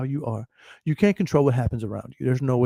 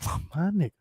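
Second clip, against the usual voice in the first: soft, offhand greeting in a put-on voice; casual greeting voice; 5 dB quieter than usual. Greeting a coworker who is cooking, softly offhand. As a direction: greet him like a buddy, quiet and easy. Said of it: "I'm like".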